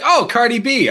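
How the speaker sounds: mock kid voice, high and loud; excited kids. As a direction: play the kids lighting up at a name. Kids recognizing [name].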